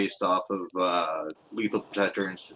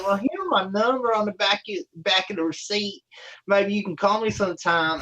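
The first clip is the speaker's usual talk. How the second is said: high, louder mock come-on; flirty drive-thru woman; 12 semitones higher, 4 dB louder than usual. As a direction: imitate her offering herself, pitched way up.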